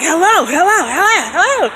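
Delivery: imitating the friend straining to hear; friend on the phone. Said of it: "He's like".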